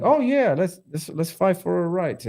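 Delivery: very high, eager mimicry; mock naive protester. Podcaster mocking protesters as useful idiots, naive high voice. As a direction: put on a gullible activist's high voice.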